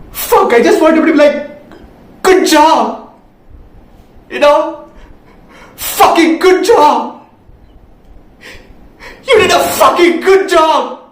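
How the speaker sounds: high-pitched, drawn-out emotional shout; impassioned outburst. Acted. Younger sibling longing for brother's approval, impassioned shout. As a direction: build to a desperate cry for praise. Overwhelmed plea for brother's praise.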